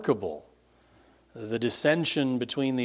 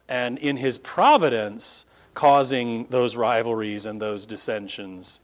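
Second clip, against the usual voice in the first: ordinary talk, nothing marked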